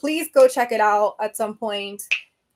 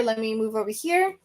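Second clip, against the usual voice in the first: usual speaking voice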